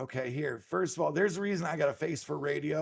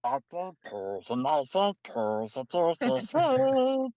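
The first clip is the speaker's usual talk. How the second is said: drawn-out creature noises; Gollum. Acted. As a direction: make strange, stretched-out creature sounds. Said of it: "if I sound like Gollum".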